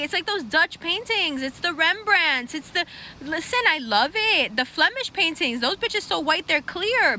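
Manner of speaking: usual speaking voice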